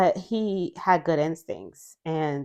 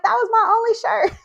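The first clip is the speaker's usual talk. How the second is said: high, fast imitation of Kendra's outrage; mock complaint; 3 dB louder, much faster than usual. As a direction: squeak it out as a hammed-up, put-upon Slayer. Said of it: "And she was like".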